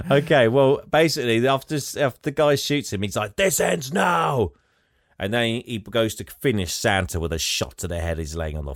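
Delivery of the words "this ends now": slow, drawn-out shout, pitched up; villain shout. Retold or imitated. Kill Squad leader standing over Santa, slow villain shout. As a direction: bark the threat slowly, like a movie villain.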